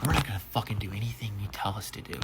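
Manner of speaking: put-on sneering whispered quote of the drunk guests; mock whisper. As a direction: hiss it back as a mocking whisper. Defiant drunk guests mocked.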